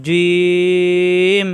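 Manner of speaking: জিম stretched very long on the ই; elongated vowel. Shown as a demonstration. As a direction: sustain the vowel in জিম on one note. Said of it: "লম্বা করা যাচ্ছে". (দীর্ঘ টানে জিম)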